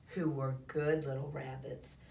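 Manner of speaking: ordinary delivery, nothing marked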